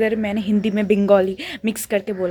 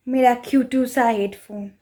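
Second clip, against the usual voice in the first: normal talking voice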